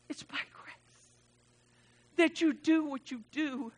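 whispered, intimate drop after the shouting; hushed whisper. Real, not acted. Pastor dropping to a whisper for the congregation. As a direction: pull the room in with a breathless hush. Sudden hushed line in sermon.

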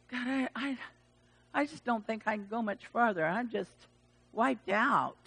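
soft, halting, worn-out prayer; acted exhausted confession; weary. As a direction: say it softly, running out of strength. Voices a worn-out believer praying.

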